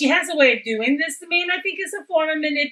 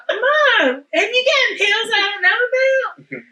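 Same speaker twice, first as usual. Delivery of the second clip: laughing; 9 semitones higher, 3 dB louder than usual. Retold or imitated. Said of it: "she'll be like".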